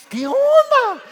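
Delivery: very high, incredulous exclamation; baffled dad. Retold or imitated. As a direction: sound totally baffled. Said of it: "y yo dije". (el papá no lo puede creer, exclamación muy aguda)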